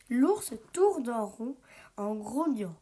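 usual speaking voice

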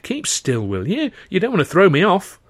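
building urgency to a loud call; urgent warning. Acted. Narrator warns captive on canal.

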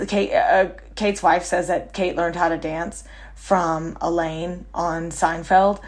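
ordinary delivery

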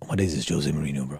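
lightly dropped, low mock voice; a hint of a lad's impression; low mock mandem voice. Mandem reacting to seeing Mourinho, low mock voice. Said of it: "we're just like".

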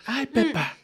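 very high little cartoon voice; imitated Peppa Pig dub. Mocking a Spanish cartoon dub, very high imitated voice. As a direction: squeak it like a dubbed cartoon. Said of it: "El Peppa Pig".

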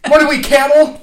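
put-on high, loud complaining old man. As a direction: kvetch loudly like an old grandpa.

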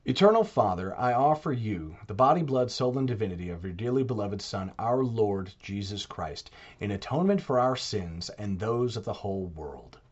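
soft, reverent recited prayer. Acted. Reciting the Chaplet as an example of praying, soft and devout.

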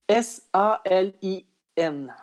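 letter by letter, slow and separate; spelling out. Shown as a demonstration. Woman spells how Spaniards write her name, slowly letter by letter. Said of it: "pour le prononcer comme ça".

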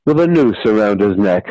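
ordinary delivery

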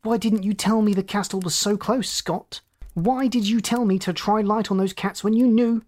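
high-pitched, cunning accusation; sudden cunning. A narrator's reading. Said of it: "Sudden cunning swept his face".

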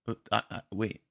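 ordinary talk

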